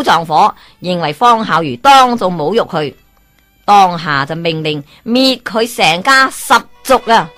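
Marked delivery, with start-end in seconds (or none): none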